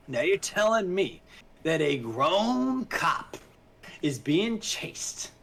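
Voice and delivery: slow, drawn-out disbelief, stretching key words; incredulous. Real, not acted. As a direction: spell out the absurdity in disbelief. Disbelief at the film's plot.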